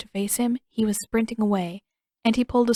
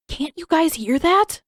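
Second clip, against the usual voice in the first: high, loud insistent question; urgent question; 8 semitones higher, 6 dB louder than usual. Acted. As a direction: make them listen. Aang urging them to listen.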